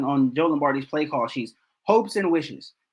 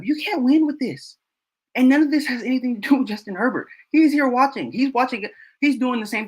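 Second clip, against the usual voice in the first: exasperated; 8 semitones higher, 3 dB louder than usual. Real, not acted. Exasperated defense of quarterback.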